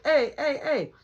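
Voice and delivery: police calling out. Retold or imitated. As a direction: hail them like an officer pulling up. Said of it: "pull up next to us like".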